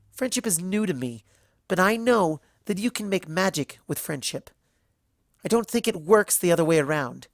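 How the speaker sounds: high, loud indignant tirade; righteous indignation. A narrator's reading. Twilight scolding Celestia in righteous indignation, loud. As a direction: lecture her mentor with fury. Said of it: "righteous indignation overtook her".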